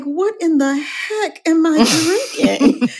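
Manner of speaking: high-pitched, playful disbelief; imitated amazement. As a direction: imitate friends' astonishment, ending in a laugh. Retelling friends' amazed reaction to champagne.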